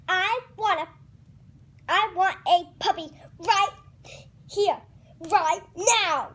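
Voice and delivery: loud, high, punching each word; demanding tantrum. Acted. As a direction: demand it like a stamping toddler. Pigeon throws a tantrum.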